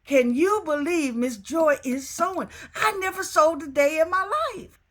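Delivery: excited. Real, not acted. Proud of her first sewing.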